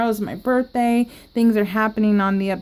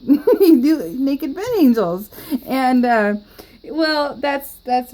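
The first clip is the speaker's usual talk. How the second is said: laughing; 4 semitones higher, slower than usual. Real, not acted. Laughing at own joke.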